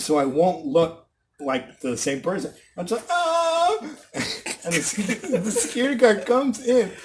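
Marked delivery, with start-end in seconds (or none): 3.1-3.8 long, high "aah"; imitated vocal noise as disguise; feigned wordless cry.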